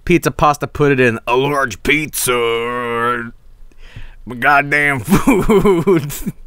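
slow, drawn-out southern twang; mock southern drawl; imitating southern drawl. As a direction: drag the words out southern style. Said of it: "a southern drawl to it".

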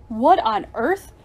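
loud, slow amazed question; astonished exclamation. A narrator's reading. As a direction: exclaim it in disbelief. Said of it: "Mister Williams exclaimed".

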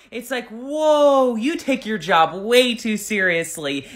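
ordinary talk

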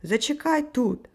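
high, mild shouted order; urgent narrated call; shouting. A narrator's reading. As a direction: call back over a distance, restrained. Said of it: "кричав він".

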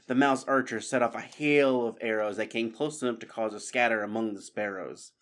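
plain reading voice

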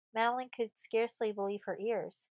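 ordinary delivery

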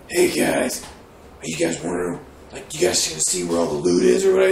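very low gamer doing a Batman growl. Retold or imitated. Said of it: "are you talking like Batman?".

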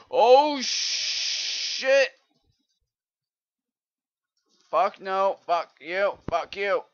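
real rage yelling at the game; furious shout. Real, not acted. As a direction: scream at the screen as the creeper blows. Gamer raging at the creeper.

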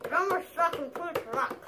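garbled through stuffed cheeks; cheeks stuffed full. Real, not acted. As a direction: mouth crammed with marshmallows. Chubby bunny, mouth overfull.

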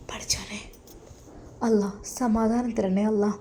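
hushed, breathless whisper of wonder; whispered to herself in disbelief; awed whisper. Acted. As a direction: breathe it out in amazement. Awed wife alone in room.

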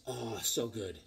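ordinary talk

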